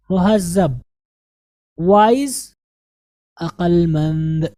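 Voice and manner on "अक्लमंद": usual speaking voice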